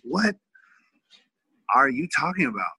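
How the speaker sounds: a father floored by his son's request; retold stunned dad; shocked disbelief. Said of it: "I was like".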